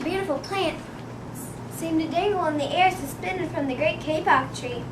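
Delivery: ordinary delivery, nothing marked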